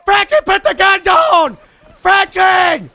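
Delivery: screamed, high and loud; desperate shouting. Acted. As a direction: scream at him to drop the gun. Yelling at officer to drop gun.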